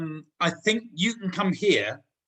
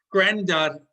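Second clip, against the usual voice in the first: usual speaking voice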